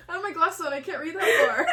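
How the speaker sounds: high, loud, laughing protest; cracking up while explaining; laughing too hard. Real, not acted. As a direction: talk through real giggles. Too overcome laughing to read.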